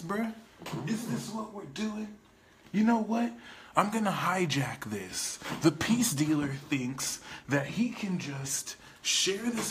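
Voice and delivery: high, mocking persona voice; indignant hijacking alter ego; dark alter ego. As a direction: take over the stream with an indignant sneer. Darker persona hijacks the reading.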